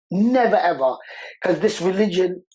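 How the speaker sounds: ordinary delivery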